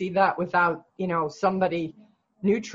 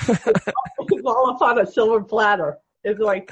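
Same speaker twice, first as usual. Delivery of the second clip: laughing along; 3 semitones higher, 4 dB louder than usual. Real, not acted. Coach joins in the laughter.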